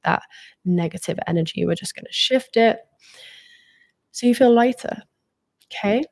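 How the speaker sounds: ordinary delivery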